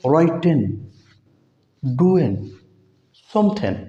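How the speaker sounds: राइटिंग ending in a light न, no g; native -in ending. Shown as a demonstration. Teacher models how English speakers say -ing. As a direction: drop the g in समथिंग, end on न. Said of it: "वो पढ़ता है".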